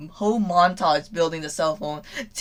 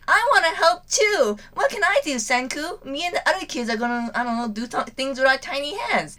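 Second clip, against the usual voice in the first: put-on much higher, bright child voice; eager little girl; 8 semitones higher than usual. Little girl asking Senku how she can help, eager high voice. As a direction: pipe up like a small kid begging to help. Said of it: "Suka comes in clutch being like".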